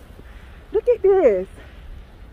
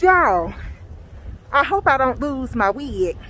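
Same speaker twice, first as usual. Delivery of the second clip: ordinary talk, nothing marked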